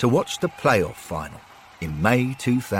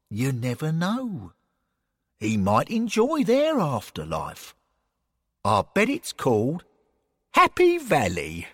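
lightly voiced, higher, musing colleague; Bill, musing; 5 semitones higher than usual. Bill speculating to Derek about a rival afterlife, musing. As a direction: muse on it unhurriedly.

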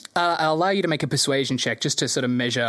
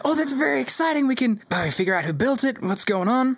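excited; 9 semitones higher than usual. Acted. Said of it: "She goes".

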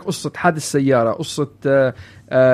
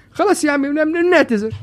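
high, sheepish apology to a stranger; mock sheepish apology; 10 semitones higher than usual. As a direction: apologise meekly, half joking. Mock apology to innocent man.

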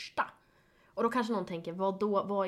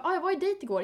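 high mimicking friend; 9 semitones higher, 3 dB louder, faster than usual. Friend voiced in retold chat.

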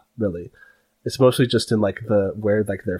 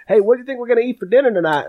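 much higher, fast, loud greeting; rushed excited call; 12 semitones higher, 4 dB louder, much faster than usual. Acted. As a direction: burst in asking about dinner quickly. Bugbear mom calls in.

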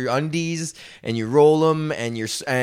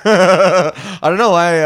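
laughing; 3 semitones higher, 7 dB louder, much slower than usual. Real, not acted. Laughing at his own freestyle.

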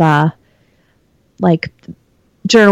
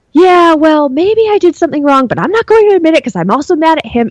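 higher-pitched childish grudge, mock-stubborn; petulant five-year-old; 8 semitones higher than usual. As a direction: sulk like a kid refusing to back down. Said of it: "this ridiculous five-year-old stuff".

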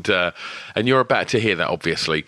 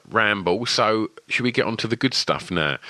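ordinary talk

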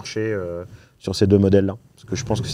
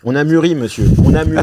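mock proud; 6 semitones higher, 6 dB louder than usual. Teasing proud declaration.

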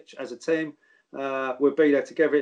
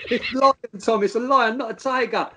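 cheeky player reply; 8 semitones higher, 3 dB louder than usual. Retold or imitated. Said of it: "And he's going".

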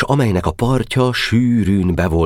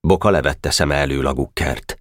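usual speaking voice

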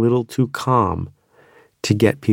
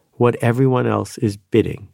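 ordinary delivery

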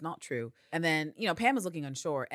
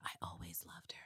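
soft breathy whisper of a dying man; 10 semitones lower, 17 dB quieter than usual. Retold or imitated. Dying Constantine's last message for Carolyn, whisper. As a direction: barely breathe the words out. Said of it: "tell her".

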